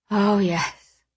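relieved sigh on the words. A narrator's reading. Friend relieved after treatment, mildly sighing. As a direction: let the words out on a light sigh. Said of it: "he sighed".

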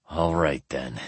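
low, angry words through clenched teeth. A narrator's reading. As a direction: hold back the anger through teeth. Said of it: "said from between clenched teeth".

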